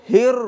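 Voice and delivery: হির said loud with a thick rolled র; heavy র in হির. Shown as a demonstration. Teacher drills হির loudly for the class. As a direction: punch হির with a heavy rolling র. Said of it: "হির কোনো শব্দ নাই".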